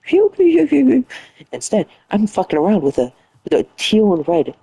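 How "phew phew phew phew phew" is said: snoring. Retold or imitated. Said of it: "Everybody's going".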